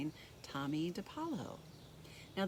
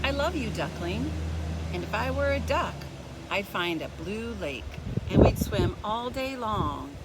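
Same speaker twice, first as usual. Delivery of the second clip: louder, higher, lively reading; excited; 5 semitones higher, 11 dB louder than usual. A narrator's reading. Reading the duck page to kids, excited and louder. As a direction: burst out with excitement.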